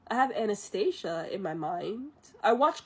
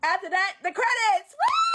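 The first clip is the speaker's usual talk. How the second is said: high, loud triumphant cheer; jubilant shout; 11 semitones higher, 6 dB louder than usual. Real, not acted. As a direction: shout it out, ending on a squealed whoop. Celebrating finishing the long retelling.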